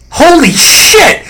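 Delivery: high, drawn-out astonished exclamation; retold freak-out. Kid realizing it's his old friend, freaking out. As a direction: re-enact flipping out, stretching both words way up high. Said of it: "I'm flipping out and freaking out".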